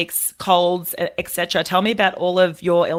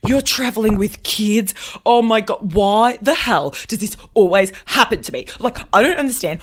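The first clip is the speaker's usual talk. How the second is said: mock rant; 4 semitones higher, 4 dB louder than usual. Retold rant of grumpy man.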